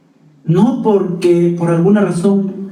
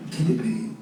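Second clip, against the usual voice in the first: regretful sigh; 11 dB quieter than usual. Retold or imitated. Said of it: "Y decimos".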